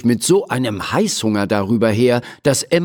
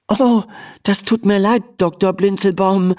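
put-on higher, flustered girl voice; stammering; 9 semitones higher than usual. A narrator's reading. Emma apologises to the doctor, flustered. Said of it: "stammelt Emma".

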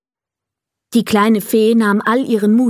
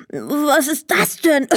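put-on slow, higher, wondering boy voice; puzzled boy; 6 semitones higher than usual. Emil wonders about the dust in his face, puzzled. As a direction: sound baffled by the dust. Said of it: "fragte er verwundert".

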